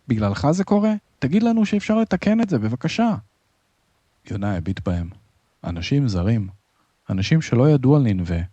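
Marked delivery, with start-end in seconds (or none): 0.1-3.2 high, desperate begging; agitated plea. A narrator's reading. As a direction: plead urgently at the top of your range. Sailor accuses and begs Jonah. (תחינה נסערת בקול גבוה)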